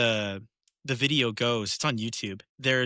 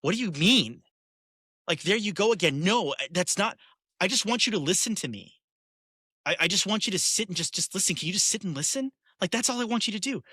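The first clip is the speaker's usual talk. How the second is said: higher, pleading wife voice; exasperated wife; 7 semitones higher than usual. Retold or imitated. Wife to husband, a higher, pleading exasperated voice. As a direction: act out a frustrated wife begging to be heard.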